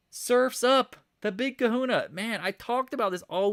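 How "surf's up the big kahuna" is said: excited. Real, not acted.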